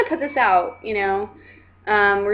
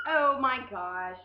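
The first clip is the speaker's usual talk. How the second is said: usual speaking voice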